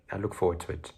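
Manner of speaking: usual speaking voice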